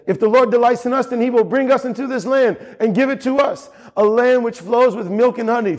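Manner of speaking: high, ringing proclamation; Joshua proclaiming. Retold or imitated. Joshua rallying the people, high ringing proclamation. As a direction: call it out boldly like Joshua. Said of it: "Joshua stands up and says".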